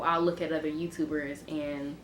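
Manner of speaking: ordinary talk, nothing marked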